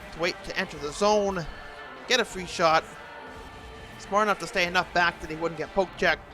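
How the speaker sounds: ordinary talk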